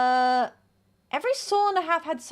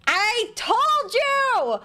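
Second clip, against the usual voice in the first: triumphant shout; 10 semitones higher, 5 dB louder, much slower than usual. Real, not acted. Triumphant after guessing right.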